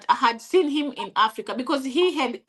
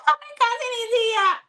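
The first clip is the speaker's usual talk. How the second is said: much higher, gushing like a TV reunion; mock joyful greeting; 11 semitones higher than usual. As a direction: ham up the happy welcome of a cousin. Mimicking TV family reunion joy.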